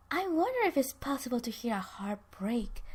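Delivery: soft, whispery acted taunt; whispered taunt. Ben wondering about a heart break, whispered taunt. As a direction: needle them under your breath.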